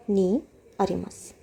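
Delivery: ordinary talk, nothing marked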